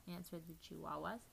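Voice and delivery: ordinary delivery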